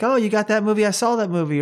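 lightly acted excited recognition; imitated eager fans. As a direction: play an eager festival-goer. Voicing festival-goers greeting the filmmaker.

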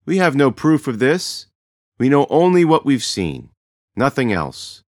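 a chief calming his frightened people, solemn and loud; chief addressing crowd. A narrator's reading. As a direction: speak to the whole great house with authority.

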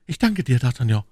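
breathless-with-joy thanks, acted; overjoyed exclamation; almost delirious. A narrator's reading. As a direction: gush thanks, starting high. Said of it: "rief Aramis fast wahnwitzig".

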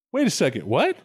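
normal talking voice

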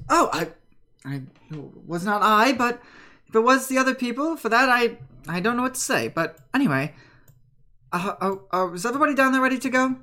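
eager crewman stumbling over his words; flustered sailor. Acted. Plays Ben, flustered.

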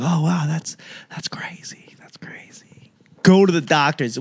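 wife whispers shock then sends him off; hushed awe then order. Retold or imitated. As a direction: breathe that's crazy, then push.

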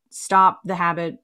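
usual speaking voice

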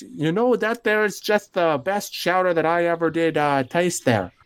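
put-on Minnesota dad accent. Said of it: "you don't need to carry the accent".

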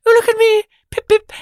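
hammed-up toff saying pip pip; little British man. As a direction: camp it up like a dainty Englishman. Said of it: "he's a little British man".

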